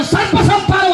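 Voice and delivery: high-pitched denouncing cry; mock pulpit denunciation, pitched right up; hard-hitting rebuke. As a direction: imitate a preacher calling out sinners. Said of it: "pastor గారు గట్టిగా వాక్యం చెప్తా".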